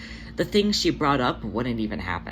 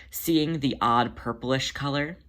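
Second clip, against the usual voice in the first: ordinary delivery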